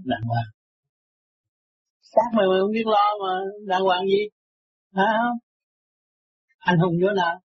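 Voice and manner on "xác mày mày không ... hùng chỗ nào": pitched-up reproachful challenge; scolding rebuke. Real, not acted. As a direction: scold him like an exasperated master. Master scolding a listener.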